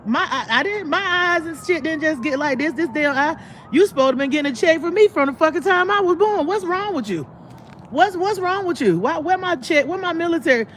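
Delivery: high-pitched exasperated tirade; mock rant. Daughter demanding her missing veteran check from mom, high and exasperated. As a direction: rail at her in a high, fed-up voice.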